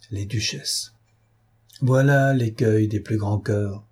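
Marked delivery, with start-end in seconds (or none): 0.1-0.9 musing under the breath; lowered voice. A narrator's reading. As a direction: drop the volume, as if talking to yourself. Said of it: "en baissant la voix et se parlant à lui-même".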